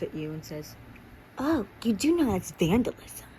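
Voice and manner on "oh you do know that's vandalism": high, loud, swooping reproach; scolding teacher. A narrator's reading. Teacher catching a pupil drawing on a desk, scolding. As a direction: tell them off in a raised, indignant lilt.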